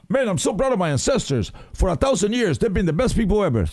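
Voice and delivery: high mocking voice of a heritage bragger; mock proud ancestor boaster. Mocking heritage braggers to his friend, high put-on boast.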